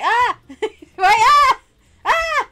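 high loud playful screams; mock screams. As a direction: shriek in mock fright at PJ. Pretending PJ attacks her.

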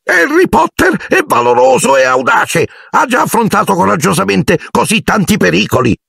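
high-pitched squeaky elf pleading; earnest, tearful house-elf. A narrator's reading. Narrator voices Dobby, tearful warning. (elfo supplicante dalla vocina acuta)

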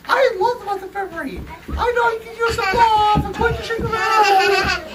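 very high, loved-up Cupid voice; high-voiced Cupid. Cupid offering love to a grump, high and excited. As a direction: squeal it as an eager Cupid.

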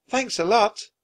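high, grateful call; shouted thanks. A narrator's reading. Annie thanking the serpent, shouting. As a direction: call it out to someone leaving. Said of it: "shouted Annie".

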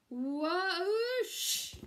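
high, loud drawn-out whoosh; magical whoosh. A narrator's reading. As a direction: sweep the sound up like magic. Second wish strikes.

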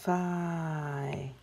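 "fi" with long f gliding into a held long I; sounding out. Shown as a demonstration. Teacher adding the next sound, "fi" drawn out. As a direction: blend "fi" slowly, each sound held. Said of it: "Next sound".

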